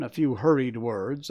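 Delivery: plain reading voice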